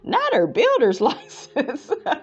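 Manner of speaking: breaking into laughter mid-word; the reading falls apart into a laugh. Real, not acted. Reading a viewer's comment aloud, cracking up.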